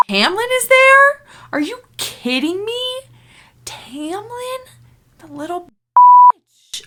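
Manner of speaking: outrage at a hated character; outraged disbelief. Real, not acted.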